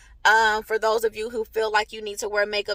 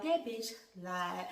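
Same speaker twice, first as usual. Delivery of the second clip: judgmental viewer; 5 semitones lower, 14 dB quieter, slower than usual. Retold or imitated. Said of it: "y'all be looking at me like".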